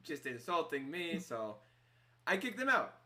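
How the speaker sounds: affronted barkeep, pitch jumping at the end; indignant innkeeper. Acted. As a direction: stretch out each insult you suffered. Game master as offended innkeeper.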